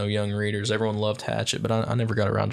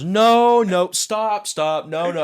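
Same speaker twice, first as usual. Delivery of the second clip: higher, louder urgent no, no, stop; teacher stopping him; 9 semitones higher, 6 dB louder, much slower than usual. Retold or imitated. Teacher halting the class reading, loud and urgent. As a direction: cut him off like a flustered teacher. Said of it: "Mister Austin goes".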